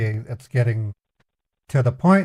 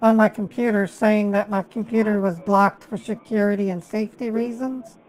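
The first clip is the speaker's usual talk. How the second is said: put-on caller voice; 5 semitones higher than usual. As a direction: play an innocent caller, pitched up. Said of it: "I have to literally pretend".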